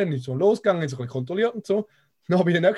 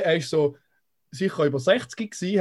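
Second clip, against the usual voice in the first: ordinary talk, nothing marked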